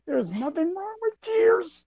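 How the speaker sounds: very high, slow put-on voice; hammed-up falsetto of a noble dad; mock wise dad. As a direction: play the teary father, high and slow. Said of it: "And I'm like".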